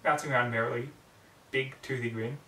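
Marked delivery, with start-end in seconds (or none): none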